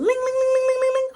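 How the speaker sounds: loud, high rapid ringing; shrill sung-out bell noise; imitated bell. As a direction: trill like an alarm bell going off. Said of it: "so you hear the ling".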